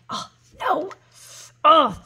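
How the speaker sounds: high-pitched "oh no", last oh loud; high, exaggerated groan of dread; mock dismay. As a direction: wail it in hammed-up horror. Retells own dismay at slipping up.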